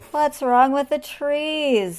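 slow, high, loud question; dismayed wail. A narrator's reading. Lion holding his head, dismayed wail. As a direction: wail it slowly, fed up. Lion troubled by shaking trees.

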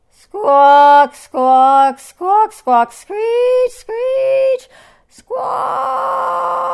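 very high, loud sustained bird screeches; bird squawking. A narrator's reading. Hornbill sings to wake the lion, squawking. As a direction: squawk each word piercingly, stretched out.

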